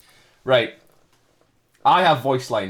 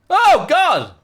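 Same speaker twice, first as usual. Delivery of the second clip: much higher, loud startled cry; shocked; 13 dB louder than usual. Real, not acted. Streamer reacting to a decomposing face, startled cry. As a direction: recoil at the gross discovery. Grotesque discovery in game.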